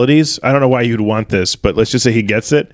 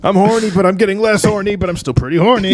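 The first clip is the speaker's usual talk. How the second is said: put-on higher, fading boyish complaint; mock whiny boy; 7 semitones higher than usual. Regressing hero complaining, voice higher. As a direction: play a kid sliding back down from puberty.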